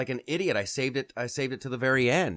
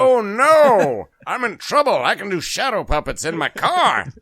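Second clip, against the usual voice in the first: mock alarm; 8 semitones higher, 6 dB louder than usual. Driver mocking the high-beam flasher behind him, mock alarm. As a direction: feign horror, hamming it up loudly.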